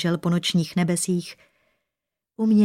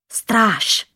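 higher, louder call for the guard; hissed call; 4 semitones higher, 5 dB louder than usual. A narrator's reading. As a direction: call the guard sharply, as a shaken king. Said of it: "sykl bledý král". (ostré, syknuté zvolání)

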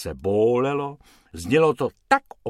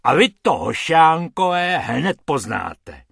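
threatening; 5 semitones higher, 7 dB louder than usual. A narrator's reading. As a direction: menace the boys. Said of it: "zařval pan Hrubec".

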